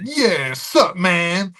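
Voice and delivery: hammed-up imitation of a hack comic's impression.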